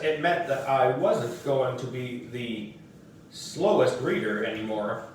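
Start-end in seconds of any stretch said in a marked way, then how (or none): none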